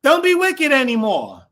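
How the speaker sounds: preachy warning voice. Shown as a demonstration. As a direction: scold them like a stern teacher. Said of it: "How does it sound?".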